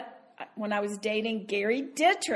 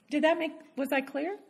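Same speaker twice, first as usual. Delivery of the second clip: usual speaking voice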